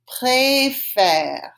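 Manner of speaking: "Préfère" with ay first, then an open eh; two distinct vowels. Shown as a demonstration. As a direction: separate the two syllables of "Préfère", drawing out each vowel. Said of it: "they're two different sounds".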